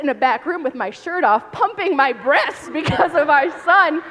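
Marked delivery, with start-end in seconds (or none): 1.5-4.0 laughs through the words, pitch up and louder; laughing. Real, not acted. Mother laughing as she tells the panel about pumping at work. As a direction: laugh it out at the absurdity.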